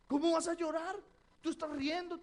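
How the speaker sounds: loud, high disbelief; mock astonishment at a laughing woman about to cry; incredulous retold question. Pastor doubting the cheerful actress can cry on cue, loud and incredulous. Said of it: "pastor disbelieves laughing actress".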